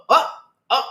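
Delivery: usual speaking voice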